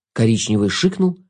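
ordinary delivery, nothing marked

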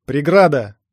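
loud narrated cry; shouted warning. A narrator's reading. Runner warns the team of the steel door, shouted warning. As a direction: call it out to the team. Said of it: "выкрикнул я".

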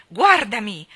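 very loud furious order; a boy yelling in rage to be seen; angry yell. A narrator's reading. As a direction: fling the command at him. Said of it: "urlò con rabbia".

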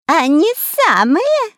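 high, loud, drawn-out taunt; clowning mockery. A narrator's reading. Spy mocks the cornered heroes, clowning and drawling. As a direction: mug it up, stretching the words. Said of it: "кривляясь, ответил Дырка".

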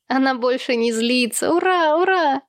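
put-on high child's cheer, rising to the hurrahs; exclaiming little boy. A narrator's reading. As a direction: cheer jubilantly like a small child. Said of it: "воскликнул малыш Сэм".

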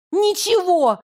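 high shriek in despair; desperate shriek. Acted. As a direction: squeal it out, desperate. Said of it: "взвизгнула я в отчаянии".

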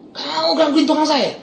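high, drawn-out scolding; retold snapping rebuke. Pastor confronting the thug over his worker, retold scolding. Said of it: "Langsung saya bentak".

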